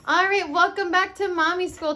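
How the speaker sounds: bright show intro. Real, not acted. Mom opening her home lesson, cheery welcome. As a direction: kick off the show with energy.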